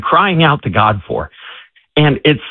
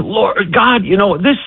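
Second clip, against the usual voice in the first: high, pleading prayer, not quite in tears; a hint of a desperate man praying aloud; retold crying out to God; 8 semitones higher than usual. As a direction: beg God for help, lightly acted. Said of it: "he's crying out and he's saying".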